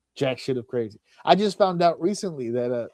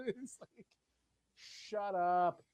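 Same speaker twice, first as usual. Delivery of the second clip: normal talking voice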